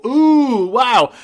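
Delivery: high, loud fake amazement; mock wowed gasp. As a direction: gush like a sucker impressed by a sales pitch. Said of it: "I didn't come in and go".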